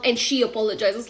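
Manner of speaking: normal talking voice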